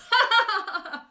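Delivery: high, loud mischievous cackle; cackling laugh. A narrator's reading. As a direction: cackle in triumph. Peeves laughs.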